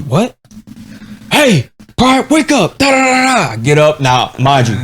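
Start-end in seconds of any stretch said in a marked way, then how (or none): 0.0-3.5 drill sergeant voice. Retold or imitated. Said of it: "the guy goes".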